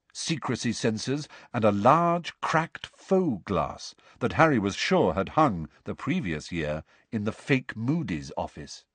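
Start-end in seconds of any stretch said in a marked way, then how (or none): none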